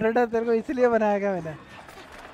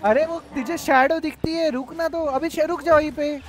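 higher, louder urgent calls to a teammate; urgent shout; 6 semitones higher, 4 dB louder than usual. Real, not acted. Gamer yelling at teammate to stop in the shadow, urgent. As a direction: yell the warning before it's too late.

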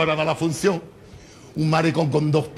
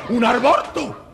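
mock disbelief; 8 semitones higher, 3 dB louder, much slower than usual. Incredulous friend repeats.

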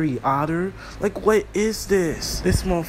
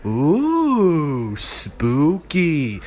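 mock spooky; 4 semitones lower, much slower than usual. Mocking a spooky character.

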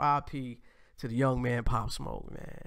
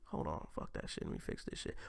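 muttered aside; 11 semitones lower, 13 dB quieter than usual. Real, not acted. Self-correcting aside, under breath.

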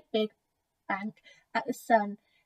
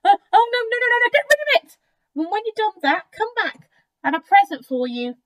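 high, loud crocodile protest; agitated story-book crocodile, high and insistent; flustered crocodile; 10 semitones higher, 8 dB louder than usual. Acted. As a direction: refuse in a panic, pitched way up. Crocodile frantically refuses the tooth.

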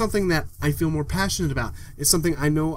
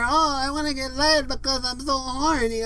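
high-pitched mock moaning; mocking complainers; 6 semitones higher than usual. Imitating negative posters on social media, high mock whine. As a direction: moan it high like an online complainer. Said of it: "They all say".

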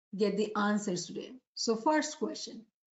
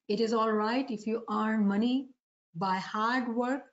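normal talking voice

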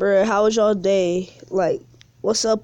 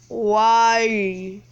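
ordinary talk, nothing marked

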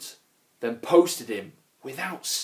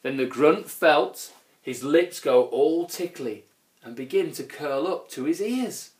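ordinary delivery, nothing marked

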